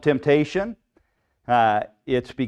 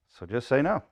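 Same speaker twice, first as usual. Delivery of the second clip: a small, soft, amused inner voice, a touch quieter; little inner voice; 5 dB quieter than usual. Retold or imitated. Preacher voicing the little voice in your head, softly amused. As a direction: gently nudge like the voice in your head. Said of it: "you hear that little voice go".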